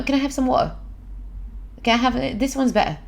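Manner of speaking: "water" and "better" with the t swallowed; British glottal stop in "water" and "better"; dropped t. Shown as a demonstration. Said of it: "il est parti où le thé?". (« water » et « better » à l'anglaise, t avalé)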